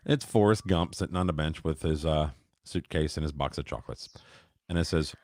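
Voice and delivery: ordinary talk, nothing marked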